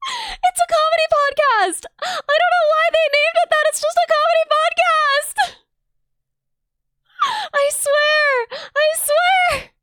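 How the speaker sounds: high, loud flustered insistence; flustered protest. Real, not acted. Streamer protesting to chat over a rude podcast name. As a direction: squeak out a defence.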